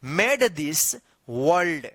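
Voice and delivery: whole sentence read very slowly word by word; slow model reading. Shown as a demonstration. Teacher reading the full sentence slowly to students. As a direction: spell it out slowly for beginners. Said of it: "तो क्या होगा".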